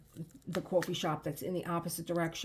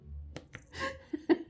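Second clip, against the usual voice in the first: high airy laugh; breathless laugh; 3 dB quieter than usual. Real, not acted. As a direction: silent wheezing laugh. Laughs at the story.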